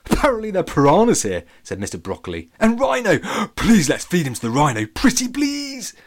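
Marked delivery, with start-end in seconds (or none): 0.1-1.5 high, loud little broccoli. Acted. Broccoli pipes up. 2.6-5.9 high, squealing begging; excited pleading. Acted. Broccoli begs to feed rhino.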